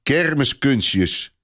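slow, drawn-out scornful dismissal; contemptuous. A narrator's reading. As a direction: sneer at the boy's tricks. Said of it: "zegt Clopin minachtend".

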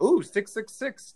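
ordinary delivery

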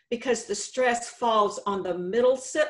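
normal talking voice

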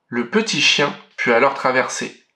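ordinary delivery, nothing marked

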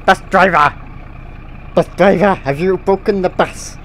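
loud call to the front of the bus. Pretend. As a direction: shout down the bus at the driver. Calling out to the bus driver.

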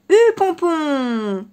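loud, slow drawn-out rider's command; giddy-up call. Acted. The fox on horseback urges the horse, loud and drawn out.

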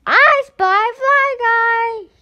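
very high, loud, slow gleeful shout; joyful cry. Acted. Boy finally finding his fly, slow loud joy. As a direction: crow it out with joy. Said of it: "cried Buzz".

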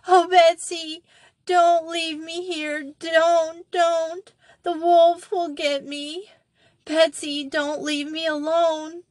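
put-on high, terrified child pleading; frantic scream. A narrator's reading. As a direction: beg in wild terror. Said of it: "Molly's cry rose to a frantic scream".

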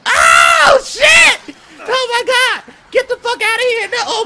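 high, loud frightened yelling; panicked screaming. Real, not acted. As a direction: yell in panic. Monster appears.

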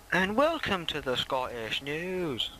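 a weak, put-on Scottish newsreader voice, slowed; mock Scottish anchor, barely there; bad Scottish accent. Kid's Scottish newsreader bit, lightly mocked Scottish accent. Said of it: "Obviously that's a really bad accent that I've just done".

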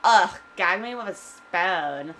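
put-on slow, drawled Valley Girl disgust. Shown as a demonstration. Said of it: "saying I always say so I can do Valley Girl".

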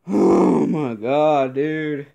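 loud, slow, drawn-out exasperated yell; furious outburst. Real, not acted. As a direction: let the anger burst out slowly. Exploding at the defense.

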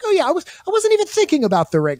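high, fast, airy denial; mock innocent Boromir. Host mimicking Boromir pretending not to think of the ring, high and quick. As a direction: squeak out a fake-casual denial. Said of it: "He's like".

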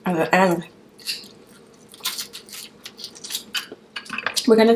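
crab at the lips; talking between bites; speaking while eating. Real, not acted. Seen 0: Crab piece held at lips, biting, throughout the labelled words.

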